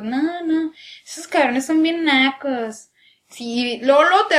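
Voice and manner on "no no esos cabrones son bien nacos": slow drawn-out posh sneer; mock posh chilango. Posh chilangos insulting the others, slow drawl. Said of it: "y los otros".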